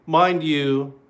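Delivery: usual speaking voice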